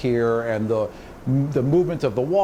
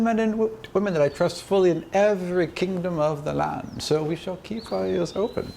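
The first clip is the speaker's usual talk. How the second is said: a higher, measured court spymaster giving a report; a hint of a loyal spy briefing her majesty; lightly voiced spymaster; 6 semitones higher than usual. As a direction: report to the queen, formal and careful.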